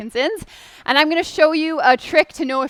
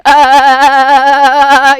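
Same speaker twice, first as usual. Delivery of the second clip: a much louder ah that shakes with each weight shift; loud trembling ah; 12 dB louder than usual. Shown as a demonstration. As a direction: belt out a wobbling ah while stepping fast. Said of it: "you should hear a nice tremble in your voice".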